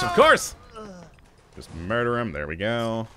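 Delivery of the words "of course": exasperated shout. Real, not acted. Enemy mutated.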